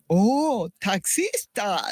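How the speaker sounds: imitating an astonished priest, high and drawn-out; high, slow exclamation of retold surprise; amazed reaction. Priest's surprised reaction to woman taxi driver.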